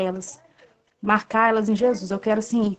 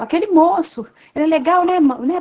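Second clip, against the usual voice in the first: high, bright child voice imitated; mother plays her little girl chirping about the nice man; little daughter's voice; 6 semitones higher, 3 dB louder than usual. Mother imitating her small daughter, high child voice.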